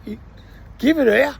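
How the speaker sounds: snatch the deal before she changes her mind; eager grab. Retold or imitated.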